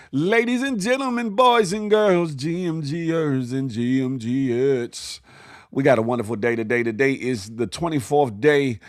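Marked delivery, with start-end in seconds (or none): 0.1-5.2 announcer intro. Acted. Host opening the show.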